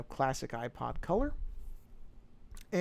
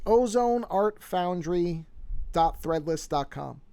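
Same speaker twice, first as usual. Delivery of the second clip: slow and clear; 3 semitones higher, 4 dB louder, slower than usual. Shown as a demonstration. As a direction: slowly spell out the web address. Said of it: "if anyone is interested".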